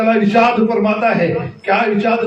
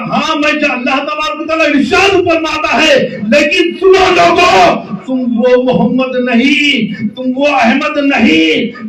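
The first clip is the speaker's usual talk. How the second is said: louder, higher sermon cry, voice breaking; impassioned preaching; 5 semitones higher, 5 dB louder than usual. Real, not acted. Preacher voicing God's promise to the crowd, impassioned cry. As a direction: pour it out, shouting and near tears.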